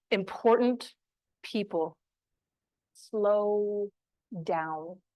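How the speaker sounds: long pauses between words, drawn-out "slow"; slow to a crawl, softening into "down"; slowed right down. Shown as a demonstration. Demonstrates slowing down with pauses.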